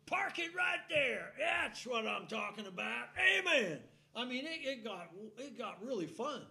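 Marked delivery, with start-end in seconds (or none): 0.0-3.8 loud, high congregation call-outs; excited church crowd shouting back; shouted amens. Retold or imitated. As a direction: holler it back at the preacher like the whole church. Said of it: "the whole church was saying".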